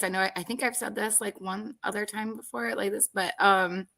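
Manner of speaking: ordinary delivery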